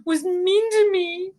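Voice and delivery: high, slow, tearful wail; mock sobbing. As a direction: blubber it out like a hurt child, hammed up. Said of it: "I was sobbing so much".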